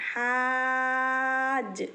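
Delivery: long held vowels, very slow; slow chanted spelling; drawn-out recitation. Shown as a demonstration. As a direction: stretch each sound like Quran reading. Teacher drawing out vowels.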